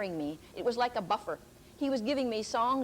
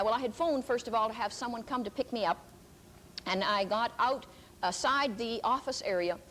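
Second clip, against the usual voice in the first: ordinary talk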